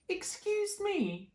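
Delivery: high, slow little insect voice; tiny cricket voice. Acted. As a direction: squeak it like a polite cricket. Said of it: "coughed a cricket".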